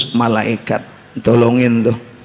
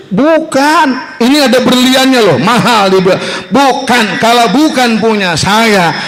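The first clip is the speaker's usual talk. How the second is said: high, earnest woodcutter refusing; honest woodcutter; 9 semitones higher than usual. Acted. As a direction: push the diamond axe away, pitched high. Woodcutter refusing diamond axe.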